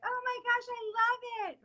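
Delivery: high-pitched imitation of an American friend swooning over an accent; gushing American friend. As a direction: gush like an excited American fan. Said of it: "All my American friends are gonna be like".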